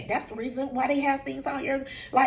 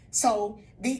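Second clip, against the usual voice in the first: ordinary delivery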